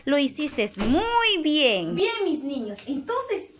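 put-on slow, sing-song praise for a small child; reading to a toddler. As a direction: praise them slowly and sweetly.

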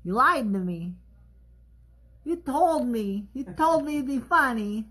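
high, whiny mock grievance; mock hurt whine. Telling the crew, high mock whine. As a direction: whine it like a betrayed child. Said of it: "mock hurt about the joke".

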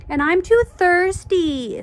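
high, loud drawn-out whine; sing-song childish complaint; whiny excuse. Acted. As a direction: whine it slowly, stretching the last word. Classmates join in the whining.

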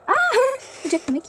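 ordinary talk

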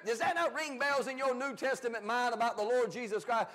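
usual speaking voice

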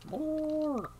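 high, drawn-out, held note; mock greedy cry.

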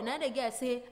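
ordinary talk, nothing marked

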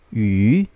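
"yu" long, dipping low then rising; third tone. Shown as a demonstration. Teacher drilling learners in third tone, "yu" dipping and rising. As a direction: sink down on "yu" and climb back up. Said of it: "how would we read this third tone".

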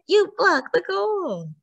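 high, drawn-out congratulation; cheering. A narrator's reading. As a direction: stretch out the cheer for a teammate. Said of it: "Emma cheered".

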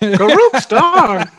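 high, slow squawking parrot. Retold or imitated. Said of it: "your parrot impression".

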